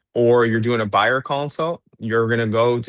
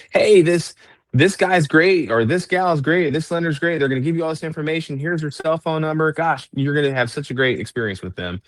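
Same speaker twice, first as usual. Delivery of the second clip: upbeat, higher salesy pitch; enthusiastic pitch; 6 semitones higher than usual. Shown as a demonstration. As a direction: sell the lender with real excitement. Coach models selling a lender.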